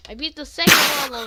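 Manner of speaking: excited, fast. Real, not acted. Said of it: "Wait. No".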